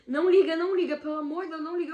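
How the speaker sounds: loud, high, frantic begging; terrified ex begging her not to call; panicked pleading. Retold or imitated.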